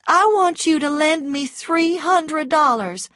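high, loud woman's plea; urgent request. A narrator's reading. Scarlett asks Rhett to lend her money, urgent. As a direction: ask insistently in a high-pitched voice.

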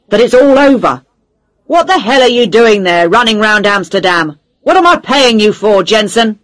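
loud, high furious editor; angry boss. A narrator's reading. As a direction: berate her down the phone.

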